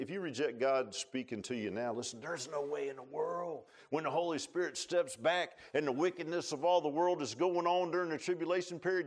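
ordinary talk

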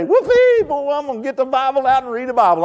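high-pitched, gleeful whoop, hammed up; over-the-top pretend morning eagerness; mock enthusiasm. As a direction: fake being thrilled, voice leaping way up. Mock eagerness he says he lacks.

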